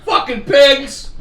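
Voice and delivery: drawn-out, high, loud jeer; loud mock insult, stretched out; mock outrage. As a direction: call it out slow and loud. Heckles the people in the story.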